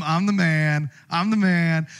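loud, proud self-praise; swaggering I'm the man; smug boast. Acted. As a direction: crow it like a braggart. Haman boasting to himself.

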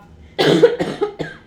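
harsh hacking cough; coughing fit. Real, not acted. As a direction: cough hard, throat clearing. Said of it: "Sorry, I have to cough".

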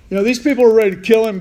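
ordinary talk, nothing marked

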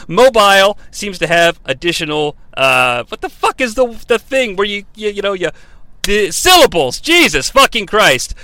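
ordinary talk

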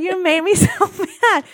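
half-laughing fake rage at her husband; laughing mock anger. Retelling her outburst at Kyle.

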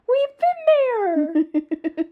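high, loud, drawn-out cry; excited. Real, not acted. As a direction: shout it out in delight. Excited, gleeful recognition.

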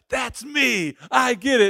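high, loud burst of recognition; gleeful optimist. Acted. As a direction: playact an eager optimist piping up. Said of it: "if you're optimistic, you're like".

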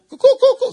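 high laughing chicken coos; playful chicken call; chicken clucking. Pretend. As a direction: cluck like a hen. Chicken says what.

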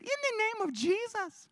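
put-on high but level child's voice. Kids correct themselves, high childish voice. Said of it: "No, they said".

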